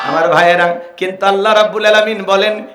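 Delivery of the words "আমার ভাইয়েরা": normal talking voice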